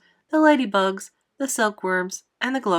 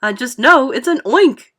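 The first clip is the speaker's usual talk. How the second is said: loud, higher-pitched cry of alarm; screamed alarm; 4 semitones higher, 10 dB louder than usual. A narrator's reading. Fire chief insists on what the monster is, screaming. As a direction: shout it with panicked certainty. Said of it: "screamed the head of the fire department".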